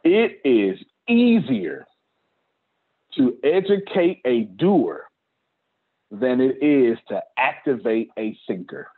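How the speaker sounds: deliberate, weighty delivery with spaced phrases; slow, emphatic dictation. Real, not acted. Speaker tells the team to write this down, slow and emphatic. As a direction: hammer it out slowly, phrase by phrase.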